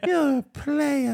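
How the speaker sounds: high, slow mocking drawl; mock boast. Friend teasing a cheating man, slow and high. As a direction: stretch it out, teasing.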